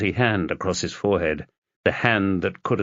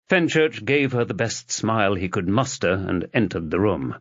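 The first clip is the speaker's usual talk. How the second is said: ordinary delivery, nothing marked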